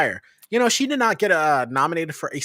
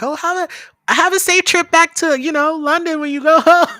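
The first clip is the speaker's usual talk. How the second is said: high, loud, gushing send-off; hammed-up friendly local, much higher and louder; well-wishing server; 10 dB louder than usual. Server wishing the Londoner a safe trip, high gushing voice. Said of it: "and they'd be like".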